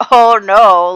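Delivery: loud, dropping dismay. Acted. Jay hearing Francesca is pregnant, loud dismayed groan. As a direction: react like the floor fell out.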